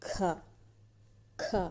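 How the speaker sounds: ఖ pushed out with a strong puff; forceful, breath-heavy ఖ; stressed aspirated ఖ. Shown as a demonstration. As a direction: press hard on ఖ from the throat. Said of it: "ఒత్తి పలుకుతున్నాం".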